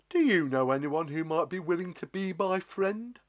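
shy, pleading giant voice; hope he'll say yes; a friendless giant asking nicely; lonely giant. Acted. Giant asks again.

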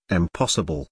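ordinary delivery

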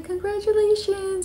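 high-pitched mock tearful squeal; tearful congratulations. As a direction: imitate congratulating someone through happy tears. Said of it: "she's crying and I'm crying".

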